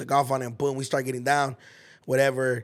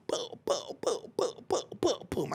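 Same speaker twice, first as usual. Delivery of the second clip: whispered then rising boom boom; tapping out the punches with his voice; punching sound effects; 6 semitones higher, 7 dB quieter, slower than usual. Retold or imitated. Retelling his fight, he mimics each punch.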